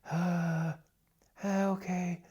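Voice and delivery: lazy, airy breathy sound. Shown as a demonstration. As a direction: let the breath leak, relaxed throat. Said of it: "if you make this breathy sound".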